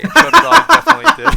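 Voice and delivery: high, loud playful jab; loud teasing yell. Real, not acted. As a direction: shout it teasingly.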